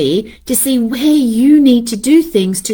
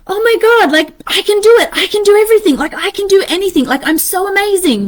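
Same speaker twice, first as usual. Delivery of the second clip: high, excited self-hype; gushing "I can do everything"; giddy overconfidence; 8 semitones higher, faster than usual. Retold or imitated. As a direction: pump yourself up like you can do anything. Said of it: "I'm like".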